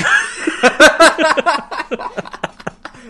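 high, loud, helpless laughing fit; real burst of laughter. Real, not acted. Cracking up at co-host's bad accent, real laughter.

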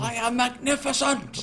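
high, soft strained proclamation; imitated triumphant cry; mock grandiose boast. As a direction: proclaim it like a hammy megalomaniac. Acts out character's triumphant boast.

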